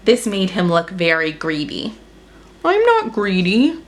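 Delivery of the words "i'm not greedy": higher, sulky denial; grumbling. Acted. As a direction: grumble it, leaning on not. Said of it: "grumbled Elephant".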